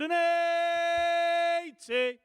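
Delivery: slow, high, drawn-out caller's roar; caller's one eighty. Acted. Darts caller announcing a maximum to the crowd, long drawn-out roar. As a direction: stretch every word like the darts caller.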